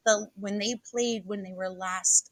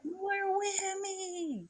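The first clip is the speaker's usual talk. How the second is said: high drawn-out quote; 13 semitones higher, 8 dB quieter, much slower than usual. Retold or imitated. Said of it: "We were like".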